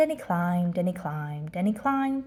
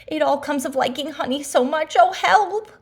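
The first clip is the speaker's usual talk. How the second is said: high, loud rueful wail; loud, high final cry for help; landing cry; 6 semitones higher, 7 dB louder than usual. Acted. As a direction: yelp as you hit the bush. Pooh landing in gorse.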